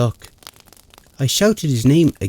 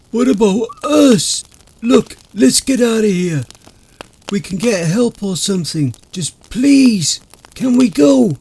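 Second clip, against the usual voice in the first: frightened begging; 9 semitones higher, 3 dB louder than usual. Acted. Friend begging to flee.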